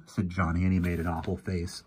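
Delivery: usual speaking voice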